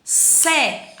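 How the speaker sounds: SÉ said alone, loud and clear; isolated syllable. Shown as a demonstration. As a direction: set sé apart as one syllable. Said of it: "Vamos separar". (sé dito isolado, com firmeza)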